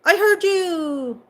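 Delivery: high, slow, strange crow voice answering; reply like a crow from far away; imitated crow answering its friend. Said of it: "and he'll go".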